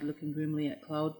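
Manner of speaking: ordinary delivery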